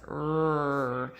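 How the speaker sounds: R as a long "rrr" sound; letter sound, not name. Shown as a demonstration. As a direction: hold R as a drawn-out "er". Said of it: "you don't say R".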